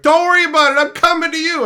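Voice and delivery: loud high call across the room; calling across the office floor. Retold or imitated. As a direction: shout it over to her.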